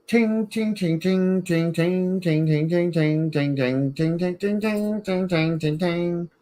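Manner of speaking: high chiming ting sounds; ting noises. Acted. Showing friend the toy's sound, high chiming tings. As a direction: chime like a little bell over and over.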